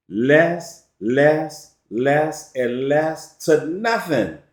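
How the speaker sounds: slow, spaced-out repeats; slowly. Shown as a demonstration. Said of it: "I'ma say it again, slowly".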